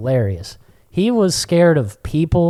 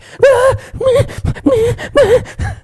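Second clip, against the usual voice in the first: loud, high repeated yelps; imitated dog whimper; 6 dB louder than usual. Puppy swimming in the alligator lake, imitated yelping. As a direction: mimic a paddling puppy's squeals.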